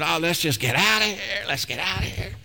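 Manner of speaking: put-on strained mother's voice; harried mother. Mother herding crying children out after shots, mock harried. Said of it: "the mother's saying".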